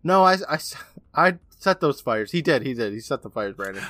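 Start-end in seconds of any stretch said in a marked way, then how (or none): 0.0-2.3 louder, higher, halting mock outburst; imitated yelled confession. Retelling Ingalls owning up, mock yelled outburst. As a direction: stammer into a raised confession. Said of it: "Ingalls yells out".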